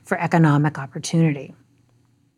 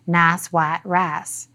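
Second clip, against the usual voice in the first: Appalachian accent; much slower than usual. Shown as a demonstration. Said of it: "stereotype a strong Appalachian accent with phrases like".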